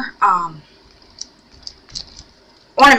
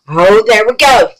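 "Oh, there we go" said louder and brighter, falling on go; pleased exclaim; 3 semitones higher, 7 dB louder than usual. Real, not acted. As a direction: announce "Oh, there we go" with relief and satisfaction. Camera finally set.

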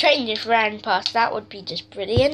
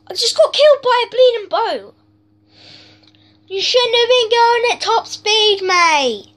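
high, loud angry outburst; furious rant at the game; raging gamer; 9 semitones higher, 5 dB louder than usual. Real, not acted. Killed by a boat in game.

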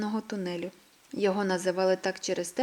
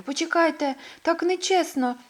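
upset protest; 8 semitones higher, 3 dB louder than usual. A narrator's reading. Left behind, protesting.

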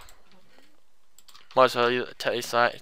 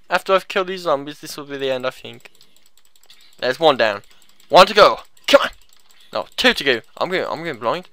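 ordinary talk, nothing marked

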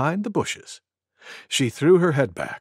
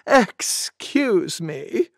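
very slow, high "excuse me"; drawn-out apology; 5 semitones higher, much slower than usual. Acted. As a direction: stretch out the apology after sneezing. After a disgusting sneeze.

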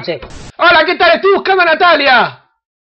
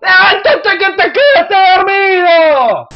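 slow, higher, strained yell to wake him; screaming wake-up call; 5 semitones higher, slower than usual. Acted. Yelling at the sleeping stranger to wake up, screamed. Waking a stranger, second louder take.

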